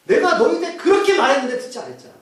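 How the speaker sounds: high, emphatic exasperated protest; frustrated. Acted. Blind man retorting to Pharisees who did not listen, frustrated. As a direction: hit it high as someone tired of repeating. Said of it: "답답하게 뭐라고 하냐면".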